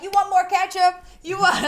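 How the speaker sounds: loud, high-pitched pushy server; mock fast-food server. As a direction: imitate a server pressing sauce on someone. Imitating stingy fast-food servers.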